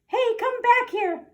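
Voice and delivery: high, loud squeaky mouse shout. Acted. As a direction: yell after the fleeing troops in a mouse voice. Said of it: "shouted the Big Cheese".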